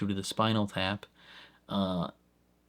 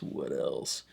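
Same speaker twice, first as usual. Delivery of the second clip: playfully dropped voice; 8 semitones lower, 5 dB quieter than usual. Pretend. Reviewer stalling for the next topic, in a mock low voice. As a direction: sink it low, a mock-grave repeat. Musing aloud, repeating the question.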